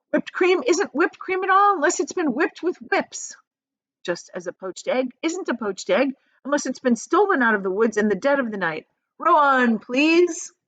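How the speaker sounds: high, loud gleeful rattle; Wonka excited. A narrator's reading. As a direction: rush through the nonsense gleefully. Wonka's manic answer.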